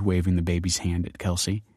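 ordinary delivery, nothing marked

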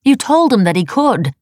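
high, loud accusation; accusing. A narrator's reading. Wife reminding husband of his promise, accusing. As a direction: throw it at him as a reproach.

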